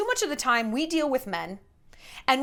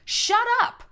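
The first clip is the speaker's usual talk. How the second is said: squealed shut up; 11 semitones higher, 4 dB louder than usual. Real, not acted. Telling the listener off, a squealed shut up going up. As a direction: Shut up jumps much higher, rising at the end. Mock outrage at own point.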